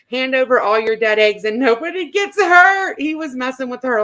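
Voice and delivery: normal talking voice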